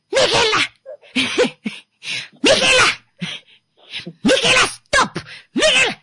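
high, loud mock yell at a sister; imitated shouting. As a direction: shriek the name across the house. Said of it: "Now the kids are also shouting".

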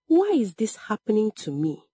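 usual speaking voice

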